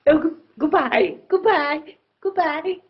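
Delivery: put-on high-pitched posh accent goodbyes; posh accent, pitched well up; posh English accent. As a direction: imitate smart, posh English people. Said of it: "posh people".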